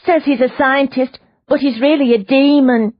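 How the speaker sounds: high, loud insistent woman's voice; agitated prisoner. Acted. Prisoner insists to Leona, high and urgent. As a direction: press the point that he is a demon.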